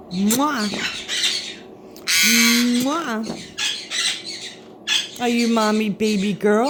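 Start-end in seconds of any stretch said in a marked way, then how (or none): 0.1-3.2 exaggerated "mwah" kiss; kiss noise. Real, not acted. Owner asks parrot for a kissy, playful kiss noise. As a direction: blow a noisy little kiss.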